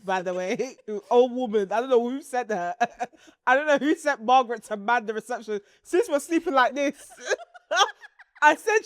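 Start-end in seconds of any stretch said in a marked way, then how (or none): none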